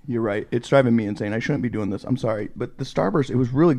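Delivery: talking through candy; mouth full. Real, not acted. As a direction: speak around a mouthful of candy. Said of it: "stop eating with candy in your mouth".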